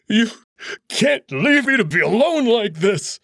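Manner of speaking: high, loud, slow desperate pleading; Amicus, begging. Acted. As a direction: cry out to him as he slips away. Amicus begs Marco not to die.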